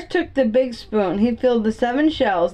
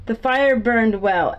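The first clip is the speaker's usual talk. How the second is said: usual speaking voice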